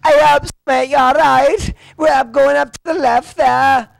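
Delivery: put-on loud, high caricature of a Scouse accent; Liverpool accent. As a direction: shout it like a cartoon Liverpudlian, thick Scouse. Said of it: "like, Liverpool, for example".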